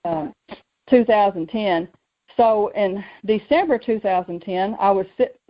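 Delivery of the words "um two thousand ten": ordinary delivery, nothing marked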